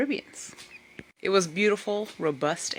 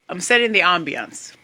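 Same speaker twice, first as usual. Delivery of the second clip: usual speaking voice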